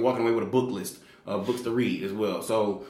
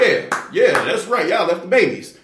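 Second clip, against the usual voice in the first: 1 crowd agreeing; 6 semitones higher, 7 dB louder, slower than usual. Retold or imitated. Crowd loudly agreeing with lady.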